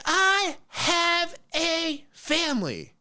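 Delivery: high, slow, whiny mock sob voice; hammed-up crying woman, like too many cigarettes; her voice, crying. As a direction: imitate her tearful complaint, stretched and high. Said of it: "She's like".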